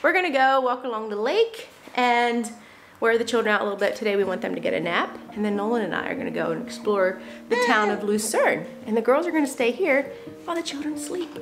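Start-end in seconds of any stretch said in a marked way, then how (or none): none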